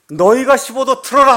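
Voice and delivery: furious, loud, high order; the commander snaps his order in anger; angry commander. Retold or imitated. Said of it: "사령관이 화가 나갖고".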